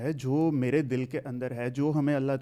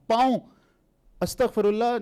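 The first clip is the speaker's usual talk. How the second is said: louder, higher, scandalised exclamation; appalled disbelief; 6 semitones higher, 4 dB louder than usual. Real, not acted. Believer rejecting the claim of God's foot, appalled disbelief. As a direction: recoil from the blasphemy, voice raised.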